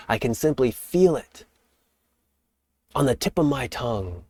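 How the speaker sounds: dream aloud as if you can already taste it; excited longing. Shown as a demonstration.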